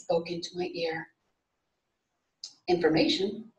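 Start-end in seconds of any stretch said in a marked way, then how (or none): none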